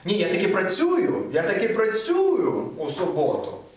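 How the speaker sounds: "працюю" stressed with a long vowel; insist, stretching "працюю"; emphasis on працюю. Shown as a demonstration. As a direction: lean hard on "працюю" in Ukrainian. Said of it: "You wanna put this emphasis here".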